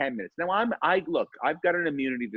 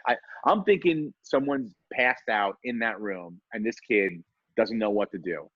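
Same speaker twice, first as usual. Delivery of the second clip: ordinary talk, nothing marked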